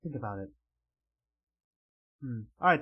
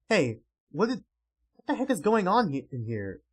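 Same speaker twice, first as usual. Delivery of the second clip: ordinary delivery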